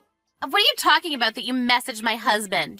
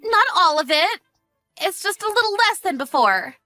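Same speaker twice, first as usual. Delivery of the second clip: high, defensive wheedling; nervous excuse; 8 semitones higher, 3 dB louder than usual. Acted. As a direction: squirm out of it, pitched high. Defensive excuse about spent money.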